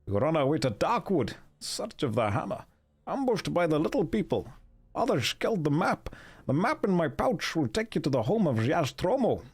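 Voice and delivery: rough Scottish-accent impression, lilting and drifting off target; Sean Connery. Dying dwarf telling of the map, in a Scottish accent. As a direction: read it in a hammy Scottish accent. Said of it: "read it like Sean Connery".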